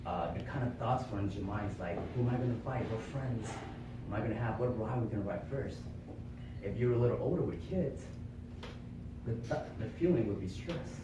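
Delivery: usual speaking voice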